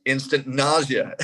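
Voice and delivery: ordinary delivery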